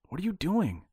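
high-pitched exclamation at ordinary volume; mild alarmed shout. A narrator's reading. Woodrow to the girl climbing the rail, mildly shouted. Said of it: "Woodrow shouted".

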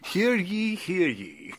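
high, ringing town crier call. Pretend. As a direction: proclaim it like an old town crier. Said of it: "old-timey words that tell you to stop".

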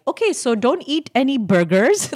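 a hint of a bossy mother's rule; mom's switch back. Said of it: "revert back to something like".

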